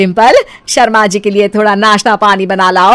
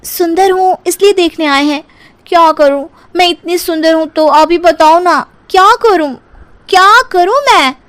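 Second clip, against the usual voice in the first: vain bride; 7 semitones higher than usual. Acted. Dimple's vain catchphrase, mother-in-law scolding.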